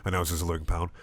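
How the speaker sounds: ordinary delivery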